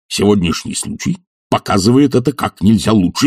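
ordinary delivery, nothing marked

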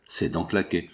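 plain reading voice

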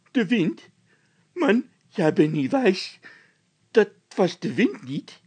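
high, loud panicky voice; frightened Bol. A narrator's reading. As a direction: panic about the noise. (Bol in paniek, hoog en luid)

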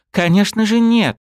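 high, loud protest; indignant cry. Acted. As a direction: protest with fervour. Girl protests loudly.

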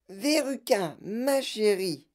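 high, drawn-out character voice; madame Salt. A narrator's reading. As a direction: call the child slowly, high-pitched. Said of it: "dit madame Salt". (voix aiguë et traînante de mère)

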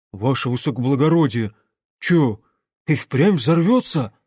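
ordinary delivery

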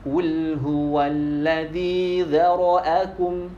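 slow Quranic recitation, zara'akum drawn out; recited quote. Shown as a demonstration. Teacher recites Al-Mulk verse to test students, slow chant. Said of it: "Benar apa salah ini?".

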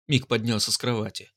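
ordinary delivery, nothing marked

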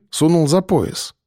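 usual speaking voice

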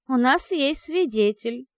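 slow, solemn proud announcement; triumphant. Acted. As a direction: announce it grandly. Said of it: "торжественно объявила я".

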